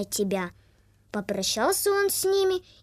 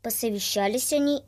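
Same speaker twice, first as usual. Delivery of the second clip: plain reading voice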